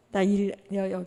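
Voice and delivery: normal talking voice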